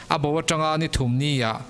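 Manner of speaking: ordinary delivery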